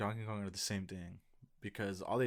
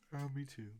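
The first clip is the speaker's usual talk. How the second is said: ordinary talk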